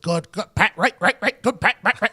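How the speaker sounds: rapid repeated auctioneer patter; staccato coach chatter; Clyde Christensen. Retold or imitated. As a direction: rattle it off like an auctioneer. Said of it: "He sounds like an auctioneer".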